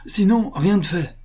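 high-pitched, urgent condition; anxious insistence. A narrator's reading. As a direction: insist while clinging to the soldier. Butcher insists on his condition.

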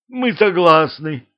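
high, eager chorus shout; shouting together. Acted. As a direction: blurt it out all at once. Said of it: "наперебой закричали зэки".

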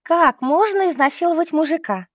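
high, mocking question; scoffing. A narrator's reading. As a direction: scoff at the idea. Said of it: "усмехнулась Лиза". (насмешливо, высоко)

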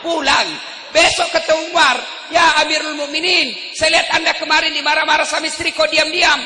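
high, loud challenge to a leader; sahabat bertanya lantang. Acted. Sahabat menegur Umar dengan lantang. (bertanya dengan lantang)

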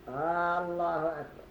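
الله with its opening hamza stretched long, as آلله; lengthened hamza of Allah. Shown as a demonstration. Teacher demonstrates a common error, stretching الله's hamza. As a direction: drag the first vowel of الله, slowly. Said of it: "فمنهم من يمد همزة الله".

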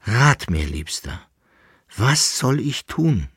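pleading lament, lifting on the final question; plaintive. A narrator's reading. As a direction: beg a lover for advice. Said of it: "klagte sie". (klagend, flehend)

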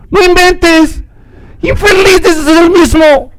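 high, crying shout of outrage; outraged cry on recognizing him; furious cry. Acted. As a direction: break into a tearful yell at the thief.

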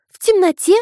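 очень высоко и громко, детское изумление; девочка поражена; удивлённый ребёнок. A narrator's reading. Дочь удивлена. (удивлённо, по-детски)

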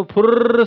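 trilled pouring noise. Shown as a demonstration. Imitated noise of salt pouring.

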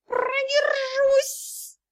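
high, very slow feline hiss; hissing kitten. A narrator's reading. Kitten retorts to the dragon, hissing and drawn out. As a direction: stretch it out as a squeaky cat hiss. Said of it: "прошипела царапка".

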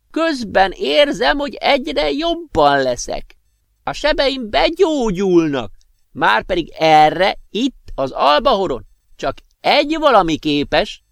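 magas, lassú öreges hang; vidám, kissé kapatos öreg professzorként; öreg professzor. Acted. Professor's voice, high and slow. (magas, lassú professzorhang)

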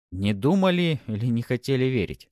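wry smirking question. A narrator's reading. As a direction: needle him with a smirk. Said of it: "с усмешкой уточнил я".

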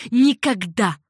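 loud gasped refusal; breathless refusal. A narrator's reading. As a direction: gasp it out, flatly refusing. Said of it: "задохнулась Риган". (задыхающийся громкий отказ)

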